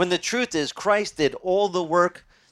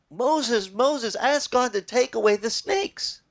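mock crying plea; 8 semitones higher, slower than usual. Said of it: "they started crying".

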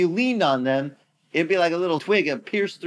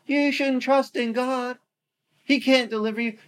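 bragging taunt; 7 semitones higher than usual. Retold or imitated. Assyrians calling out to city, high bragging taunt. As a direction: crow over the city from the wall. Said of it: "That's how they were talking".

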